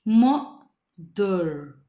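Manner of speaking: two separate phonics chunks, म then दर; divided in two. Shown as a demonstration. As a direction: break it into म and दर. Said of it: "बस टू में divide किया है".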